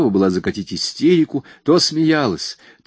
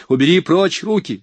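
higher, louder angry cry; angry shout; 9 semitones higher, 4 dB louder than usual. A narrator's reading. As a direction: push him off with your voice. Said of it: "зло закричала она".

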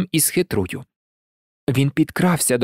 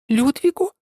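tiny, very high startled squeak; frightened squeak; 12 semitones higher than usual. A narrator's reading. Chick finds the hero in the henhouse, frightened squeak. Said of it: "злякано пропищала Тутта Карлсон".

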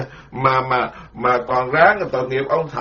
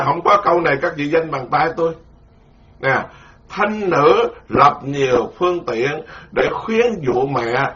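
normal talking voice

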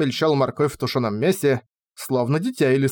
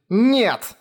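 cutting shout; 9 semitones higher, 3 dB louder than usual. A narrator's reading. Cutting Gourry off.

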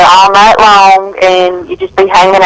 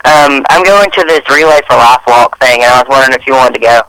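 a caller on speaker; phone speaker; 7 semitones lower than usual. Real, not acted. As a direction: voice over a speakerphone. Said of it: "you're on speaker".

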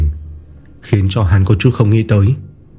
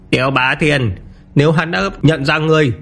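raised, higher character voices; eager henchmen; 6 semitones higher than usual. A narrator's reading. Comrade tells the rival to strike, he agrees, eager and high. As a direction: pitch it up, rising into a cocky acceptance.

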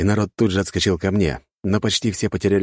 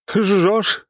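high, gleeful chuckled cry; laughing exclamation; 4 dB louder than usual. Acted. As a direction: laugh it out with glee. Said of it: "хохотнула Надежда".

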